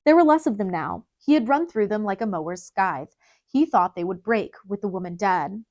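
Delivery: ordinary delivery, nothing marked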